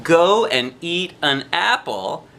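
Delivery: ordinary delivery, nothing marked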